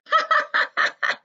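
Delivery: put-on high giggling squeal of a tickled girl; squealing giggle. A narrator's reading. Girl being tickled by her dad, squealing giggle. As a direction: let out a tickled girl's squeal. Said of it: "She squealed".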